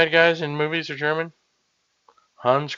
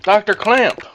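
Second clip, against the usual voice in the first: higher, insistent call; louder calling out; 7 semitones higher, 4 dB louder than usual. Acted. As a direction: call harder to get the doctor's attention. Calling the doctor a second time.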